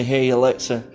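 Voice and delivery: ordinary talk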